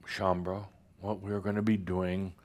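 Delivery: normal talking voice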